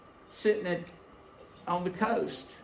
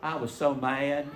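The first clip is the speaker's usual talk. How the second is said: normal talking voice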